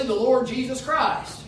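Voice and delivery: ordinary delivery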